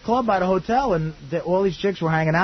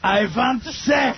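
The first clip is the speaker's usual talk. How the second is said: mock German accent; 6 semitones higher, much slower than usual. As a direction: bark it like a German vamp.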